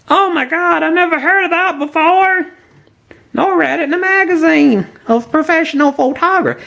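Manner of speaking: high, whiny mock voice; sneering impression of a doubter; mocking skeptical amateur.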